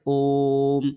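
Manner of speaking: ओम stretched to triple length on the vowel; slow, sustained ओम; prolonged vowel. Shown as a demonstration. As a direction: hold the O of ओम long and steady before closing on m. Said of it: "इसका तात्पर्य है तिगुना समय".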